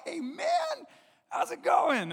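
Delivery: imitating the friendly man; cheerful greeting. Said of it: "He goes".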